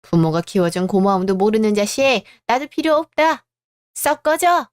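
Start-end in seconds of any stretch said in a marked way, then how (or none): 2.5-4.7 high, sharp mother's outburst; angry dismissal. Acted. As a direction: drive him out of the house. Mother throws son out. (높고 날카롭게 화내며 내쫓기)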